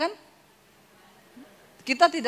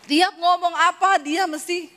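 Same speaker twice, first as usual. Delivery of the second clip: ordinary delivery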